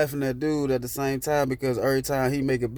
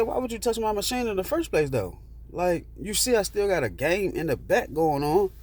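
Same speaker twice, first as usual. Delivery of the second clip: indignant challenge; 7 semitones higher than usual. Retold or imitated. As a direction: confront him in a high, disbelieving voice. Retold confrontation over the machine.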